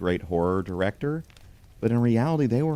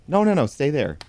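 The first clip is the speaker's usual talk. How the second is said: high, louder quick-fire protest; insistent, high-pitched plea to stay put; urgent command; 8 semitones higher, 4 dB louder than usual. Acted. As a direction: call out to stop someone leaving. Stopping penguin from moving away.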